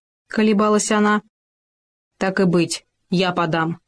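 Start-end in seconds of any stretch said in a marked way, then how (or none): none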